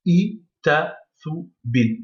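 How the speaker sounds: "i, ta, thu, bin" split into separate syllables with small pauses. Shown as a demonstration. Teacher reading the whole word back, "i, ta, thu, bin" syllable by syllable. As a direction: sound out "i, ta, thu, bin" one piece at a time. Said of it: "si on revient, ça fait".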